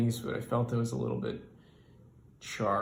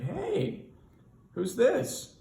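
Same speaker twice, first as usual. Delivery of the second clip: boss voice; 12 semitones higher, much slower than usual. Retold or imitated. Boss voiced in standup story.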